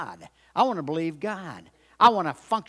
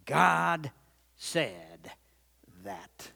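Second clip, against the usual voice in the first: slow words fading to very soft; self-reminder; 2 semitones lower, 6 dB quieter, much slower than usual. Acted. Preacher reminding himself periodically, slow fade to near silence. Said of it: "I need to say to myself".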